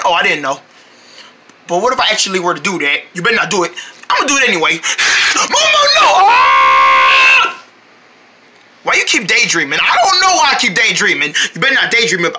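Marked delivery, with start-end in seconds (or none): 4.8-6.1 alarmed shout. Acted. Stopping Momo jumping in. 6.3-7.6 falling scream. Acted. Falling into the heater.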